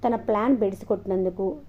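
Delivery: plain reading voice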